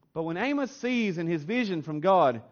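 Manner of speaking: ordinary talk, nothing marked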